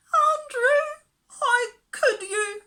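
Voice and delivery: high, loud, slow wail; moaning. Acted. Miss Lark reproaching her dog, moaning. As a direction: moan it in wounded dismay. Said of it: "moaned Miss Lark".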